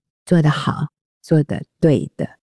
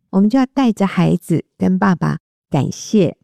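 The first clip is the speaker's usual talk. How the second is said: ordinary talk, nothing marked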